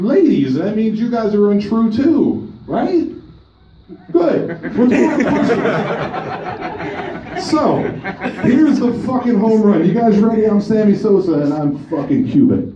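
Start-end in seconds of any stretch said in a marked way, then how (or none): none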